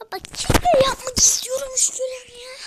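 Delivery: loud, high exasperated yell; frustrated shout. Real, not acted. Frustrated at the game. (sinirle bağırarak)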